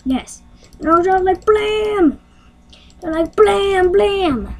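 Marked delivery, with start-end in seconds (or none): 1.4-2.2 high, drawn-out blam; imitated blast. 3.4-4.6 high, drawn-out blam; imitated blast.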